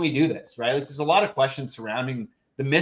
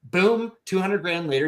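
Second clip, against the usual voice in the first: normal talking voice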